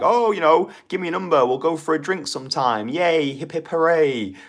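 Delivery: mock-feeble high-pitched close; vague, apologetic afterthought. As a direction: toss it off in a high, hammed-up apologetic voice. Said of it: "super vague, almost apologetic afterthought of a close".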